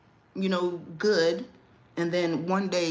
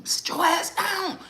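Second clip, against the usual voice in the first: higher, slow mock stern order; mock stern mom; 13 semitones higher, slower than usual. Mother pretending to scold her kid by speakerphone, mock stern. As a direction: bark it like a strict mom, playfully. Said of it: "I got you".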